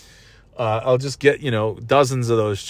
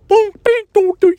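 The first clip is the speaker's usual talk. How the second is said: high squeaky cartoon boom and ding noises; Three Stooges sound effects; 4 dB louder than usual. Describing the Sopranos redone with Three Stooges sounds, high goofy cartoon noises. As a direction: mouth the slapstick bonks like a cartoon. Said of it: "That's not what the Three Stooges sounds".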